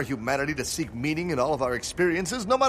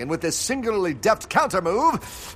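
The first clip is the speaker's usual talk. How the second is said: normal talking voice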